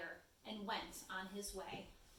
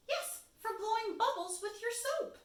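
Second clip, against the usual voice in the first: Little Bird voice; 11 semitones higher, 5 dB louder than usual. Acted. Bird explains to cow.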